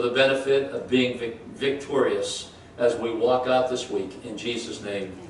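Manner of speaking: low, hushed pastor's prayer. Acted. Prayer before the sermon.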